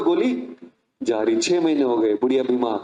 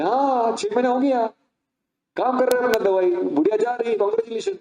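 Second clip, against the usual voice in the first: high, mocking congratulations; smug gloating; 8 semitones higher than usual. A brother gleefully congratulating his sister on the dying mother-in-law, smug. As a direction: rub it in with mock cheer.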